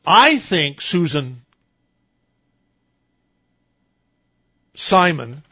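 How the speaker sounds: long dramatic pause between Susan and Simon. Shown as a demonstration. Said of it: "that long pause between her first and last name".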